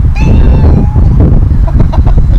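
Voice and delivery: a burst of squealing laughter; high cackling laugh. Real, not acted. Laughing hard while telling teammate stories.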